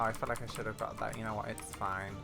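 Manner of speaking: usual speaking voice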